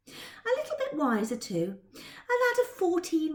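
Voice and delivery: plain reading voice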